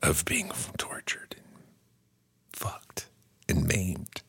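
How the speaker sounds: soft slow dread whisper; ominous whisper. Real, not acted.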